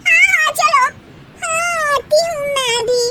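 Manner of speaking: high, whiny agreeing; youngest daughter-in-law. Acted. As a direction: tag along eagerly.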